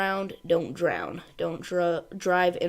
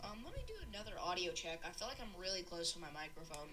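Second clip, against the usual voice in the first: much softer, distant from the microphone; mic backed away; 22 dB quieter, faster than usual. Real, not acted. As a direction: step back from the mic and talk. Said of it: "I'm gonna back my microphone up".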